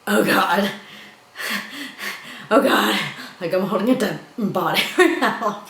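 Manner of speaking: loud, high cry of horror breaking into laughter; horrified laughing cry. Retold or imitated. Marguerite holding the dead body, loud horrified laughing cry. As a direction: shriek it, half horrified, half laughing. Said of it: "she's like".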